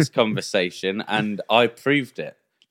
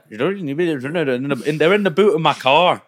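slow, higher Scottish accent imitation; Scottish taxi driver; 5 semitones higher, 3 dB louder, much slower than usual. Mimicking the Scottish taxi driver to mates, slow Scottish accent. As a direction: slowly imitate the Scottish cabbie. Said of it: "thought the taxi driver ... another language".

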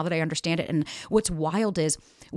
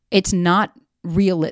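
ordinary delivery

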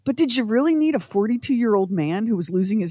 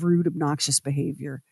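usual speaking voice

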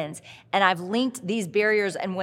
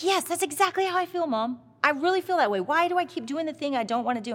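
lightly voiced son; 7 semitones higher than usual.

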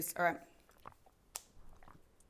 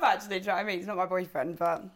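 ordinary delivery

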